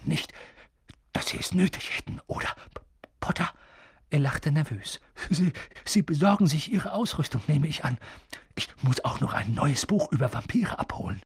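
anxious stuttering teacher, half whispered; nervöser Quirrell. Acted. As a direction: shrink away, stammering. Quirrell redet nervös weiter. (Quirrell, ängstlich und halb geflüstert)

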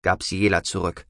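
usual speaking voice

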